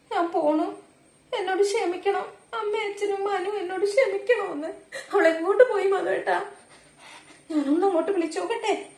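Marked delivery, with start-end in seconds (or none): 0.1-4.7 tearful reading. Acted. Mother reads daughter's farewell message. 5.1-6.4 panicked plea. Acted. Mother panics over missing daughter.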